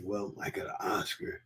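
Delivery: hushed inner-voice whisper; whispered thought. Retold or imitated. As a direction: whisper it to yourself. Thinking to himself before asking.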